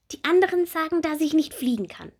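high, bright little bumblebee; small bumblebee voice. Acted. Little bumblebee Bommel asking Marie about her wings, high childlike voice. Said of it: "seufzt die kleine Hummel".

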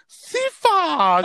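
high, loud mom calling her name; mock mom yelling her name across the table. Mom scolding daughter at the table, high and loud. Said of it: "She's like".

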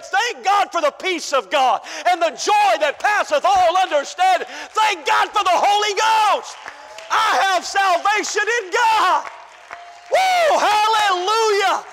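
shouted, high praise; fiery preacher's shout; preaching at full force. Real, not acted. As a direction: lift the church with a full-throated shout.

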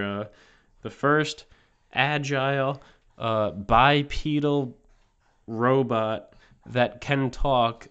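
booming slow showman welcome; announcer intro. Acted. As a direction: present the guest like a big TV reveal. Host introduces robot guest, showman style.

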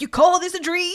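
high swaggering greaser voice; greaser tough guy. Retold or imitated. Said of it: "it's a greaser voice".

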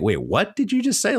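as if you can't believe your ears; surprised double take. Retold or imitated. Washington's double take, voiced.

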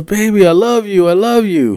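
high pleading drunk voice, mock tender; a drunk boyfriend begging his angry girlfriend; drunk lovey boyfriend. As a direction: whine it like a drunk lover. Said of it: "he goes".

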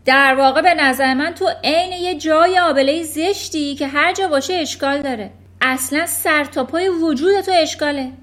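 loud, high furious yelling; shouting. Acted. Trunchbull screaming at Eric over his spelling. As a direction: bellow insults at the trembling boy. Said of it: "ترانچبول فریاد کشید".

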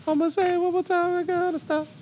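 high falsetto squeak, laughing; high squeaky lyric. Retold or imitated. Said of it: "He's saying".

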